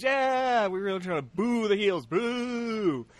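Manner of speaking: drawn-out high cheer and boo; rally the audience to cheer, then boo; hammed-up crowd-warmer call; crowd hype. Acting out host rousing the crowd.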